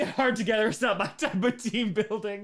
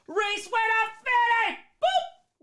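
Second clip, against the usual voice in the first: very high, loud squeal; high excited pet talk; 7 dB louder, slower than usual. Real, not acted. Excited cooing at cat.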